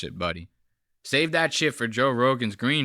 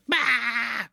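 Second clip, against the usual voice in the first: startled yelp; 11 semitones higher than usual. Retold or imitated. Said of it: "she goes".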